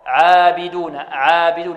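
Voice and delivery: عابدون with a thin, light aa; light ayn. Shown as a demonstration. Tajweed teacher models correct reading for pupils, light thin vowel. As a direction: keep the عا of عابدون thin and fronted. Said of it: "كذلك مرققة".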